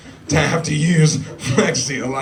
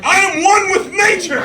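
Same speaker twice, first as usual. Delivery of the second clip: mock-heroic exclamation, high and loud; triumphant cry; 6 dB louder than usual. Running beside the cow, proclaiming to nobody, triumphant.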